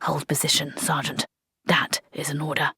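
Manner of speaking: officer quietly restraining his sergeant; calm whisper. Acted. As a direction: keep it low and controlled. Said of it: "said Blouse calmly".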